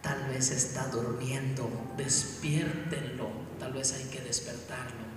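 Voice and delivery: slow, soft mocking taunt. Elías taunts the priests of Baal, slow and mocking. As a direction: tease them gently and slowly. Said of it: "Elías se burlaba de ellos".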